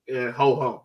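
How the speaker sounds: ordinary talk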